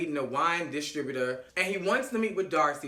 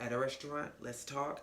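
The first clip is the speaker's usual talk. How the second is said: low, very soft aside; imitated quiet explanation; 4 semitones lower, 11 dB quieter than usual. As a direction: explain it gently, playing her. Voicing her explanation.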